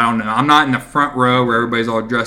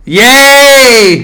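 sarcastic cheer; 11 semitones higher, 10 dB louder than usual. Real, not acted. Mock cheer at video block.

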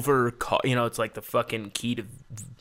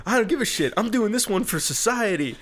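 retold rant pitched high and louder, speeding up; hammed-up manic rant; 10 semitones higher, 4 dB louder than usual. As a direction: play up a mock-grandiose rant, laughing by the end. Acting out his own manic rant.

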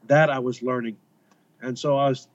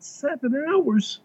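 high, drawn-out disbelieving echo; retold astonishment; 11 semitones higher than usual. Traveller tells of hearing how far villagers walked, astonished. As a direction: retell it incredulously, pitched way up. Retelling his astonished reply in dialogue.

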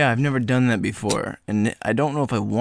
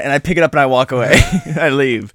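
ordinary delivery

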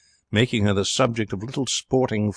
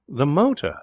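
higher, rapturous exclamation; poet's cry; 8 semitones higher, 3 dB louder than usual. A narrator's reading. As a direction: seize on the word with enthusiasm. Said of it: "cried Mister Barstow".

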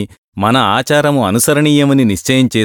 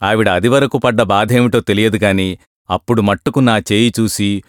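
ordinary delivery, nothing marked

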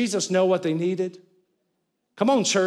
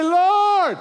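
high, loud, pleading shout; real loud cry; 8 semitones higher, slower than usual. Retold or imitated. Blind men crying louder to Jesus, loud high plea. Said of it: "So now they get real loud".

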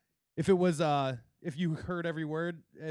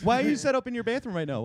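mock outraged; 6 semitones higher, 5 dB louder, faster than usual.

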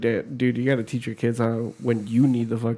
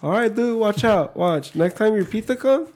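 put-on toothless kid; 6 semitones higher than usual. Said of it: "He's like".

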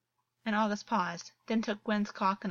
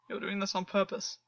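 deep voice. A narrator's reading. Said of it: "voice deep".

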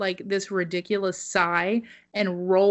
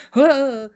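sharp loud hammed-up huff; loud preacher gasp; 6 semitones higher, 8 dB louder than usual. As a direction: huff loudly like the worked-up preacher. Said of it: "as he's like".

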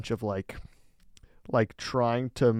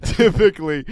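higher, louder restart through laughter; laughing; 10 semitones higher, 7 dB louder than usual. Real, not acted. Podcaster cracking up as he restarts his explanation, laughing. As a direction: try to get the word out while cracking up.

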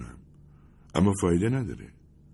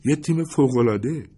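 laughing; 10 semitones higher, 4 dB louder than usual. A narrator's reading. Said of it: "خندید و گفت".

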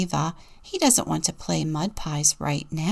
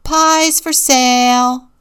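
loud, higher, slow market call; louder, stretched street-seller cry; 5 semitones higher, 9 dB louder, slower than usual. A narrator's reading. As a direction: hawk the pies out loudly and slowly. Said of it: "Ava called".

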